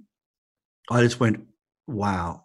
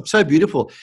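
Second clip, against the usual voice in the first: ordinary talk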